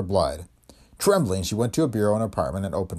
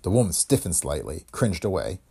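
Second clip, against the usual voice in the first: ordinary delivery, nothing marked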